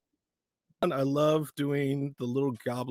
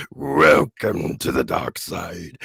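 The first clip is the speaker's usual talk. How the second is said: much lower, slow dark voice; dark villain voice; 10 semitones lower, 6 dB louder, much slower than usual. Shown as a demonstration. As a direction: welcome them in, menacing and slow. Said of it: "that really dark voice".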